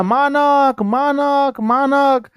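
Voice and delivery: mother crying out for her son; calling out his name. Acted. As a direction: call his name into the dark. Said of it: "फिर बोलती है बिस्नी उसी तरह".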